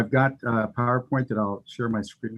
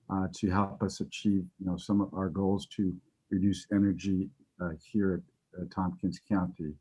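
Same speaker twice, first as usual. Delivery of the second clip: softer voice trailing away; quietly fading, losing projection; 2 semitones lower, 5 dB quieter than usual. Real, not acted. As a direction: let the volume drop until listeners strain to hear. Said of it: "You're fading a little bit".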